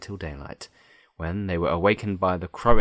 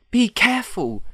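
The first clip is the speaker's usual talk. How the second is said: very high, loud warning; crying out; 6 dB louder than usual. A narrator's reading. The green girl warns Dorothy, crying out. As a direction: call out sharply in a girl's high voice. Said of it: "cried the green girl".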